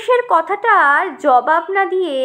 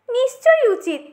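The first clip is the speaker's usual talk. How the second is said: prim agreeing tomato; 7 semitones higher than usual. Acted.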